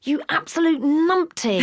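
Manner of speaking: high, slow self-scolding; angry at herself. Retold or imitated. Mother scolding herself for a bad threat, exasperated. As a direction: call yourself a numpty, exasperated. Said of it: "I was so angry with myself".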